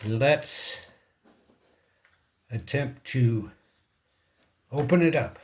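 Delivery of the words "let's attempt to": ordinary delivery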